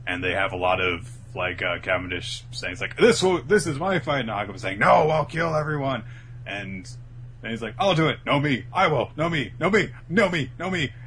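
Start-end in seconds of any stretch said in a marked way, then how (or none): 3.0-4.2 high, loud mock protest; defiant arguing. Acting Cavendish arguing with Hakuba. 4.8-6.0 loud, raised mock threat; murderous retort. Acting Hakuba arguing back. 7.8-10.9 high, insistent back-and-forth squabble; childish bickering. Retold or imitated. Two personalities bickering in one body.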